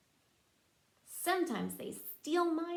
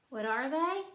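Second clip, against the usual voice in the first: normal talking voice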